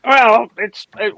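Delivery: ordinary delivery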